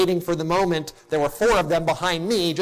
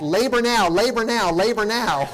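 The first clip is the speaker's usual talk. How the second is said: raised, insistent chant of the warning; urgent alarm call; 7 semitones higher, 3 dB louder than usual. Retold or imitated. Pastor mimics the monitor flashing labor now, urgent raised chant.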